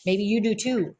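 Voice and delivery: ordinary talk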